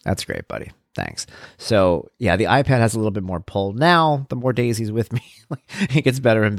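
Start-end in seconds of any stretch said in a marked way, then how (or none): 0.0-1.3 low, soft, deadpan; mock-wounded dad muttering his thanks; deflated thanks. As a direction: drop it low and quiet, underwhelmed. Dad deflated by son's choice.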